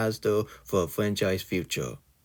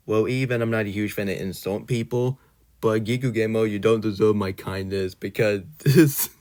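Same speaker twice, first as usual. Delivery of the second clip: ordinary talk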